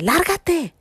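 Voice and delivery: high-pitched, sharp order to leave; retold shout. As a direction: imitate a woman yelling someone away. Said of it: "le están gritando". (grito agudo imitado)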